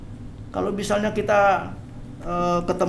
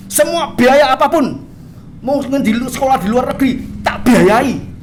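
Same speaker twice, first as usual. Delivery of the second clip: loud, raised-pitch pledge; father's emphatic promise; 9 semitones higher, 6 dB louder than usual. Acted. Father promising his child to fund any studies, emphatic. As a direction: proclaim it high and loud, as a father would.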